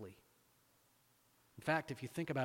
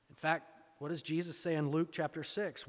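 ordinary talk, nothing marked

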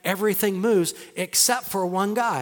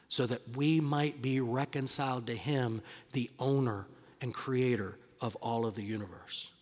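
tender hush; 5 semitones lower, 7 dB quieter than usual. Real, not acted. Pastor softly marveling that God loves his people. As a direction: speak it with quiet awe. Tender wonder at God's love.

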